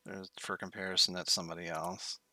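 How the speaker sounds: usual speaking voice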